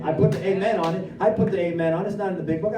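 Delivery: ordinary delivery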